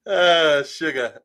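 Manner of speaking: ordinary talk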